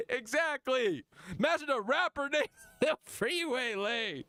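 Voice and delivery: high, laughing through the words; helpless high-pitched laughing speech; real laughter. Real, not acted. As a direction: crack up as you say it. Cracking up at the rapper name.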